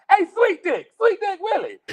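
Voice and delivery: high, loud call across a yard; teasing call. Retold or imitated. Calling out to coworker Willie across the yard, high and loud. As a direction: yell a nickname at a coworker, grinning.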